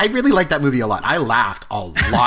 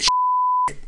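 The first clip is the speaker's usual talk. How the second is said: squeaky high curse. Retold or imitated. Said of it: "he said".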